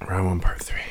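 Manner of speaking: whispered echo "Round one, part three". Real, not acted. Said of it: "echoes in a whisper".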